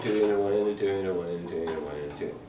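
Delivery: fast counting. Shown as a demonstration. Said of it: "it becomes a very fast".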